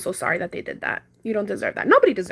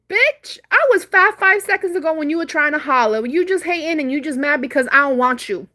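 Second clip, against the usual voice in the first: high, loud retold clapback, easing off; 5 semitones higher, 4 dB louder than usual. Woman telling off a rejected flirt in public, so loud. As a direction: launch it loudly at the guy who insulted you. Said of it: "And I said it so loud".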